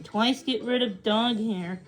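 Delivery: plain reading voice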